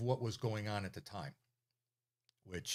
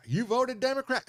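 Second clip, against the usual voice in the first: loud, high-pitched mock grilling; exaggerated incredulous question, pitched way up; interrogating governor; 13 semitones higher, 7 dB louder than usual. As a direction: imitate a suspicious old official pressing the question. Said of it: "and he says".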